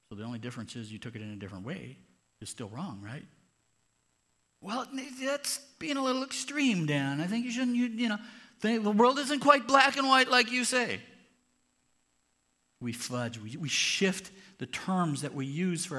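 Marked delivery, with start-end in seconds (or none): 4.6-11.0 much higher, loud whining complaint; whiny excuse. Acted. Cheater whining back at Dan, high and loud. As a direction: whine as if Dan is being unfair.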